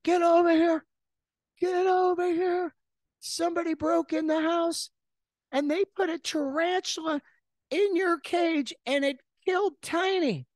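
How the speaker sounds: mom screaming down the line about the break-in; frantic mom. Retold or imitated. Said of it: "and she's like".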